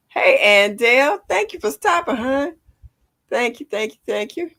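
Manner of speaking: high, loud sing-song thanks; bright greeting. Real, not acted. Greeting a viewer in chat.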